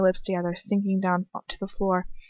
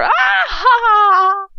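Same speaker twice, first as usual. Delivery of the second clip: very high, loud villainous laugh; shouted laugh of triumph; triumphant cackle; 13 semitones higher, 8 dB louder, much slower than usual. A narrator's reading. Bellatrix celebrating, shrieking triumphant cackle. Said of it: "shout of triumph and laughter".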